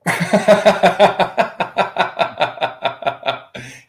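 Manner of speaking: long, high rattling laugh; big belly laugh. Real, not acted. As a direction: burst out laughing and let it run down. Reaction to a crude joke.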